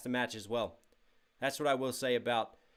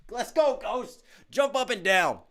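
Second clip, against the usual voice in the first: high-pitched hype yell, bursting out; excited celebration; 8 semitones higher, 5 dB louder, slower than usual. Real, not acted. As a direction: celebrate a big win, voice jumping up high. Celebrating a hundred dollar donation.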